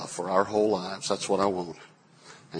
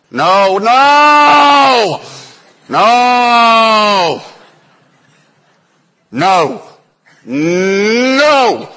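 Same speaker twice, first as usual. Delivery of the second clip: high, loud hammed-up shouted no; mock shout; 12 semitones higher, 11 dB louder, much slower than usual. Father shouting no at his strong-willed son James, mock yell. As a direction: yell it over and over like at a stubborn toddler.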